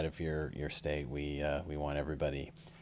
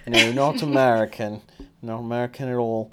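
put-on loud, higher, goofy non-American accent; 6 semitones higher, 10 dB louder than usual. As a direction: play up a silly accent that is clearly not American. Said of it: "Certainly not an American accent".

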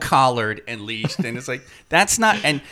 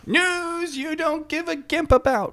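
mock news announcer; 12 semitones higher, 7 dB quieter than usual.

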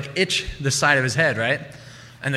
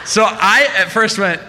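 ordinary talk, nothing marked

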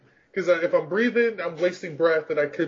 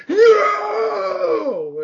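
long, high anguished scream; hammed-up raging howl, loud and drawn out; immediate yell; 5 dB louder than usual. Player acts out losing to an opponent, immediate yell. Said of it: "I immediately yell".

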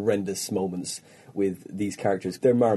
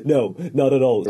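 normal talking voice

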